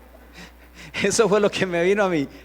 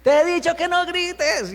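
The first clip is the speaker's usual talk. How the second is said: much higher, drawn-out scolding yell; hammed-up exasperated mother's shout; 10 semitones higher than usual. Mother out-shouting her child, mock yelling. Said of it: "mamá está gritando más duro".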